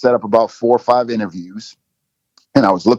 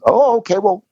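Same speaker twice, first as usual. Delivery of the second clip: higher, halting mock surprise, imitating his own hesitation; caught off guard; 11 semitones higher than usual. Retelling how he reacted to the coach's invite, higher, in hesitant mock surprise. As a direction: stammer it out like you've been cornered into agreeing. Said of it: "And I'm thinking".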